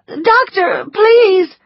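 high, loud tearful plea; crying plea. A narrator's reading. Scarlett begging the doctor, crying. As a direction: beg him through tears. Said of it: "she cried".

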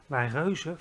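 plain reading voice

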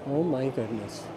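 normal talking voice

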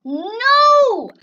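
loud, high drawn-out cry; anguished yell. Real, not acted. Player killed in the game, anguished yell. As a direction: wail the word at full voice.

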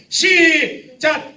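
high, loud, long start; drawn-out loud start call. Shown as a demonstration. Pastor starts the congregation's clapping, drawn-out loud call. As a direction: call it out slow and big. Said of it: "한번 쳐보세요".

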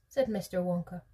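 plain reading voice

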